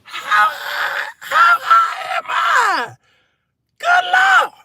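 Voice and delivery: high drawn-out panicked wail; comic panic. Retold or imitated. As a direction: shriek it in mock terror. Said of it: "And I was like".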